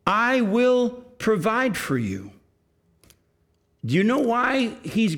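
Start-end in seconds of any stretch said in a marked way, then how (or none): 0.1-2.3 slow, higher, tender promise; comforting. Acted. Joseph promising his brothers care, slow and tender. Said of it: "And he then says".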